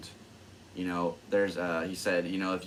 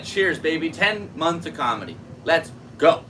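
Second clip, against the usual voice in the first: higher, louder happy toast; excited cheering; 8 semitones higher, 7 dB louder than usual. Real, not acted. Comedian toasting his ten months of comedy, excited cheering. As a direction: raise a glass and cheer.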